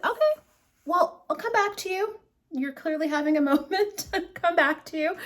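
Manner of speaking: very high, comically offended politeness; playfully humoring someone moody; mock placating. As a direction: back off with exaggerated, high-pitched tact. Acted reply to snubbing alpaca.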